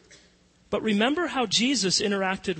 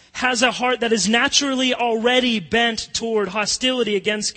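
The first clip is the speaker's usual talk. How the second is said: preaching; 5 semitones higher, 5 dB louder than usual. Acted. Rising preaching build.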